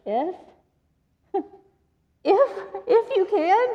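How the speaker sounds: high, questioning disbelief; "If" rising in amused disbelief; incredulous. Acted. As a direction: repeat his doubt back at him. Jesus echoing incredulously.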